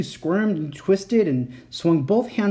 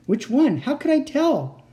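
panicked; 10 semitones higher than usual. A narrator's reading. Panicked indecision.

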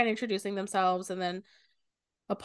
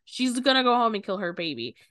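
louder, higher retold warning; alarmed outburst; 5 semitones higher, 6 dB louder than usual. Apollo warning the group about the baby, louder mock alarm. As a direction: urge them, half-shouting.

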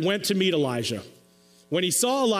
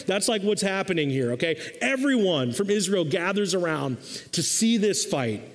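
usual speaking voice

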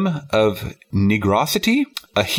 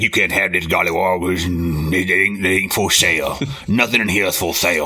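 southern voice; 5 semitones lower than usual. Retold or imitated. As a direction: play the museum owner with a Southern accent, low. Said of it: "that's my southern voice".

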